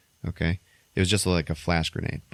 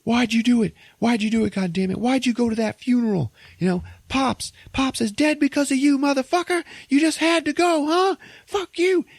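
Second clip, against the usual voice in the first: enraged brother yelling, voice cracking high; furious shout. Retold or imitated. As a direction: scream it at the brother who betrayed you. Brothers' angry confrontation.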